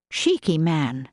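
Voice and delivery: plain reading voice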